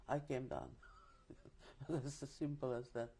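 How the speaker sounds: ordinary talk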